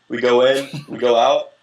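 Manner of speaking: ordinary delivery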